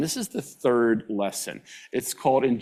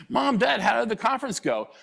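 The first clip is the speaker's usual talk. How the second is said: put-on eager child; 13 semitones higher than usual. Child greeting parents after conference.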